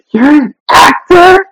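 high, drawn-out incredulous question; mock disbelief. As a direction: imitate someone who can't believe it. Retelling their disbelieving question.

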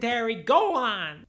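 high, dismissive mobster; lightly hammed Italian shooing; Italian guy voice. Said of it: "my Italian guy bit".

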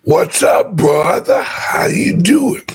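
hoarse, croaky frog-in-throat voice. Real, not acted. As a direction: talk through a frog in the throat. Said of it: "swallowed a frog".

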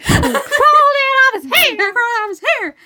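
high, loud hammed-up southern lady; laughing southern belle panic; funny accent. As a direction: repeat it laughing in an over-the-top southern accent. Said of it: "that's the funnest accent to do".

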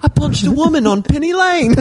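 high, hammed-up British voice; mock British accent. Cohost improvising Beatles tale, high British accent. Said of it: "do a British accent".

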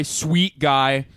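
ordinary talk, nothing marked

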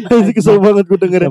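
high laughing complaint; laughing exasperated. Real, not acted. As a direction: laugh while grumbling. Tertawa kesal. (tertawa sambil kesal)

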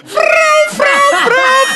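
mock a game-show fail buzzer; high buzzer frau. Efeito sonoro após piada ruim. (som de buzina aguda cômica)

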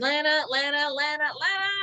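high, insistent repeated name; imitated nagging call. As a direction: mimic pestering someone by name. Imitates Archer repeatedly calling Lana.

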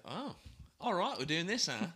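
upbeat Australian twang; Aussie accent. Pretend. Host starting a bit in an Australian accent. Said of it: "we can talk like Aussies".

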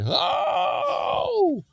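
high, drawn-out comic yelp; hammed-up scream. Man bitten on the bottom, mock scream. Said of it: "the man screamed".

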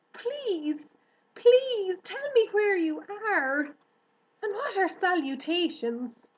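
high, loud begging; pleading pig. A narrator's reading. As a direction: plead urgently to the hidden voice.

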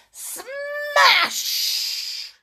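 high, drawn-out crash word; booming smash effect. A narrator's reading. Shards hit the ground, dramatic crash word. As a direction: stretch it like breaking glass.

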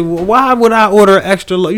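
high, loud, outraged question; indignant customer. Pretend. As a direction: squeal out the complaint. Complaining about a wrong-size baby.

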